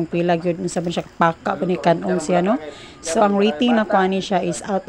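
speaking between bites; talking while chewing. Real, not acted. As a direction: mouth full of food. Seen 0: Bites the crispy pork at 1.7s, then chews visibly while speaking.

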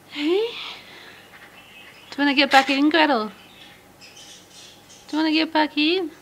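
high, sing-song coaxing to a bird; pet talk voice. Real, not acted. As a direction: coax a shy budgie out. Coaxing Gretel back in.

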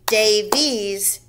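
"Davies" in two even beats, day, vies; split into syllables. Shown as a demonstration. Teacher counting beats in a name, "Davies" syllable by syllable. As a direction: break "Davies" into separate beats. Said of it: "how many beats".

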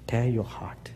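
hushed plea to the congregation; whisper. Acted. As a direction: breathe it out urgently. Preacher's intense low plea.

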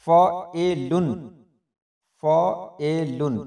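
फ़एलुन slowly split as फ़-ए-लुन; demonstrated reading. Shown as a demonstration. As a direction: sound out फ़एलुन in three separate, deliberate syllables. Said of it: "इस तरह पढ़ेंगे इसको". (फ़एलुन को तोड़कर धीरे-धीरे)

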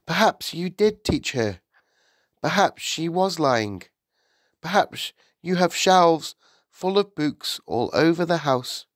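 formal, probing schoolteacher; pointed Miss Honey. A narrator's reading. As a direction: list each possibility pointedly.

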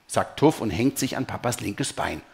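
usual speaking voice

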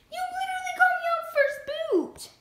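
high, loud, fast complaint; indignant yell. Acted. Mochi protesting against the rival who called her out, high and loud. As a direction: fire back at her rival in a squeaky outburst.

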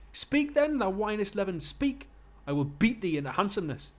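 angry Geordie bruiser, high and loud; big strong Geordie losing his temper; Geordie accent. Acted. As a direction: bellow insults in Geordie. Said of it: "give him a Geordie accent".

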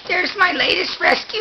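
usual speaking voice

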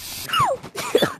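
shrill burst of laughter; high-pitched giggle; high squealing laugh. Real, not acted.

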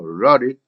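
"wrote it" linked as "ro-rit"; flapped T. Shown as a demonstration. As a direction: soften the T of "wrote it" into a quick flap. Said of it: "L이 됐네요".

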